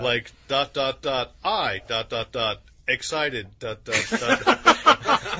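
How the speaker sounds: each dot spoken slowly; reading out ellipses. Shown as a demonstration. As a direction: drag out the last dots, laughing. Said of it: "pull these quotes from".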